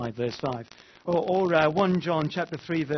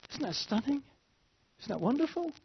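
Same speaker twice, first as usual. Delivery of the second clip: awed wonder; 10 semitones higher, 6 dB quieter than usual. Real, not acted. Preacher marvels at God's adoption.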